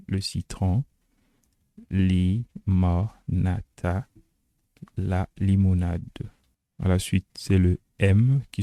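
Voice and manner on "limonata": LIMONATA very slowly split as li-mo-na-ta; syllable by syllable. Shown as a demonstration. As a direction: sound out LIMONATA with a gap after each syllable. Mot turc découpé en syllabes. (LIMONATA détaché très lentement, li-mo-na-ta)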